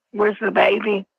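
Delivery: normal talking voice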